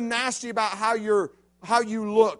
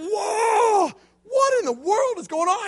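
higher, louder, stunned; dazed inner thought; 11 semitones higher, 5 dB louder, slower than usual. Retold or imitated. Punched down again, a stunned higher inner cry. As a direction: think it out loud while seeing stars. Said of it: "I'm thinking".

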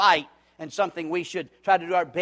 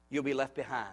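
softer, weighty echo; solemn quiet repeat; 4 semitones lower, 9 dB quieter than usual. Shown as a demonstration. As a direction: let the warning sink in softly.

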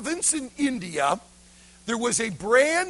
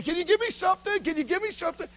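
lightly voiced beggar child, higher pitch; begging boy; 7 semitones higher, much faster than usual. Crippled beggar boy asking passers-by for something, begging. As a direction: plead quickly in a higher child's voice. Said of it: "he would be begging".

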